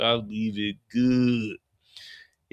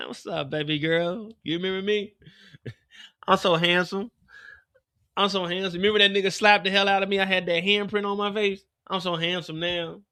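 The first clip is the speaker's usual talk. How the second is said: Tremont, smug; 6 semitones higher than usual. Acted. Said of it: "Tremont like".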